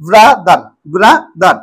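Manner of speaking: ব্রাদার in two separate beats, ব্রা then দার; split into syllables. Shown as a demonstration. Teacher showing a two-syllable word, ব্রাদার said syllable by syllable. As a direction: pause between ব্রা and দার. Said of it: "ব্রাদারটাও সেই একইভাবে".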